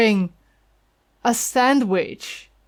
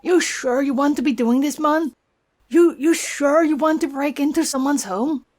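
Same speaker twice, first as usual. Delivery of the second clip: high, perky Welsh sidekick voice; chirpy Welsh fairy; Welsh accent; 5 semitones higher, faster than usual. Acted.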